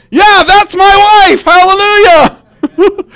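slow, high, loud joyful shout. Retold or imitated. Husband shouts for joy at seeing wife, slow and loud. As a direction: cry out hallelujah in relief.

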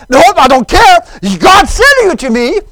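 high, full-force acted yell; shouted insistence. Lost man insisting God sent the driver, shouting. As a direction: override the objection at top volume.

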